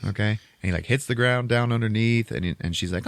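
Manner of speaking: normal talking voice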